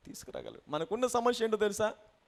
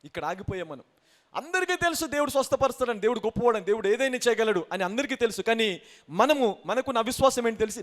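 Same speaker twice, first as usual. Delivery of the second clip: ordinary talk, nothing marked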